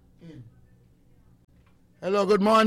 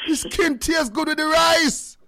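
higher, slow mock scolding; scolding wife; 11 semitones higher, 5 dB louder than usual. Husband teasingly scolds wife for skinning chicken, slow and higher. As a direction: draw it out like chiding his wife about the chicken skin. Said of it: "And me I say".

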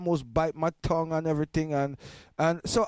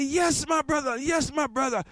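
excited preacher; 12 semitones higher than usual. Retold or imitated. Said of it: "And then he say".

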